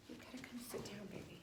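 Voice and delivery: whispered classroom aside. Real, not acted. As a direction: hush it to the little one. Teacher hushes a child aside.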